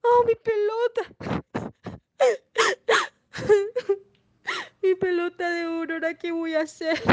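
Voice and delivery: high, hammed-up wailing with sobs; mock-crying, high and drawn into sobs; desperate crying. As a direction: act out a princess bawling in despair. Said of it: "se puso a llorar, se desesperó".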